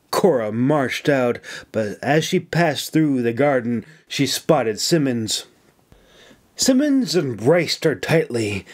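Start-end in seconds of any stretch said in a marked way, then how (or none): none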